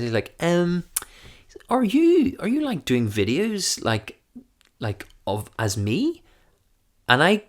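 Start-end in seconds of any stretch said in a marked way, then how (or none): 0.4-6.2 hesitant posh friend, starting very high; Mark, polite and posh. Retold or imitated. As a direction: imitate a polite posh man feeling his way into a question. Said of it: "he's so polite and posh".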